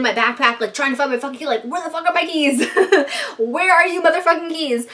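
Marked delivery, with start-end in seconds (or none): 1.6-4.9 higher frantic wail, laughing through it; hammed-up panic over lost keys; mock paranoid panic. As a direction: act out freaking out, amused. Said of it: "start getting fucking really paranoid".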